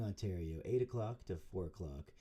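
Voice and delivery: normal talking voice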